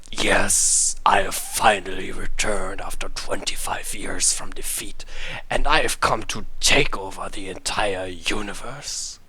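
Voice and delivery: low, loud menacing villain. Acted. Shadow Krakow gloating to everyone about his return, low menacing villain voice. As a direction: boom it out like a cartoon villain.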